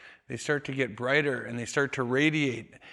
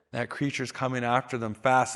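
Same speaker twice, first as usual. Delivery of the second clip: normal talking voice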